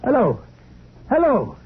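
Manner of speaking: trying to get an answer from the line; calling into the phone. Acted.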